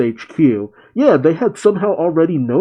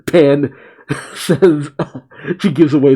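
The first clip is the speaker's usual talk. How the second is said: real giggle breaking into the narration; laughing while talking. Real, not acted. Narrator cracks up at Pan's superhero pose, laughing. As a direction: try to narrate through laughter.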